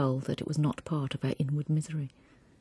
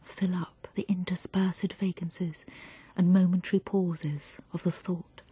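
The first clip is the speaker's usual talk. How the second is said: tender poem reading; slower than usual. A narrator's reading. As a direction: read it as if beside a sleeping baby. Reads a tender lullaby-like poem.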